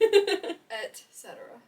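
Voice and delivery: "Et cetera" very slow, with a clear T in "Et"; corrective pronunciation. Shown as a demonstration. Correcting a friend's word, "Et cetera" slow and precise. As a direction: spell out "Et cetera" as et-SET-er-a, slowly. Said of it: "it's not pronounced like that at all".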